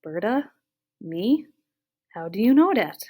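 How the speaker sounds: rising, incredulous questions; bewildered, pitch sweeping up; baffled disbelief. Acted. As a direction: build from puzzled to loudly astonished. Giant question mark above his head.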